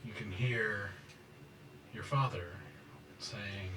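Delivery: ordinary talk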